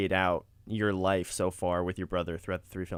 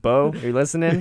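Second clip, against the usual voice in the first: loud, higher playful hail; mock announcement, raised voice; calling out; 5 semitones higher, 8 dB louder than usual. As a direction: pretend to summon someone listening.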